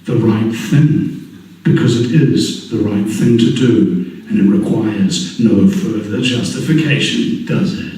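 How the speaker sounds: slow, weighty speech delivery; measured rally opener; rousing speech. Acted. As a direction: address the crowd like a rally.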